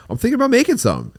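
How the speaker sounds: high, fast faux-casual hinting; mock innocent hint. Husband baiting his wife into cooking, high and airy. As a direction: drop a hint airily and quickly. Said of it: "And you go".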